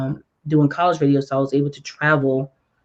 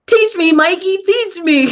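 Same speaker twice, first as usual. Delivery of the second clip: high-pitched mock plea, louder; hammed-up helpless begging; pleading; 14 semitones higher, 5 dB louder than usual. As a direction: squeal for help, half laughing. Acts out begging to be taught.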